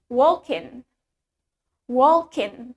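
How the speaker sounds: walking as WALL-kin, ending on a plain n; Irish silent G. Shown as a demonstration. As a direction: drop the g from "walking". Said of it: "In Irish accent, we pronounce it as".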